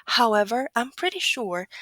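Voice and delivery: ordinary talk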